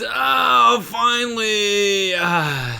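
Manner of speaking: high, drawn-out cry of relief; relieved homecomer. Acted. Man home from work letting off steam, exaggerated relief. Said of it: "He gets home from work".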